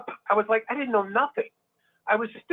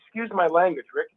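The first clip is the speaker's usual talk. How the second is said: ordinary talk, nothing marked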